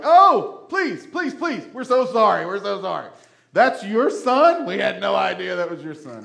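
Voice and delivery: terrified hyenas. Retold or imitated. Hyenas begging Mufasa for mercy, high frantic pleading. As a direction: grovel like a scared cartoon hyena. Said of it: "the hyenas are like".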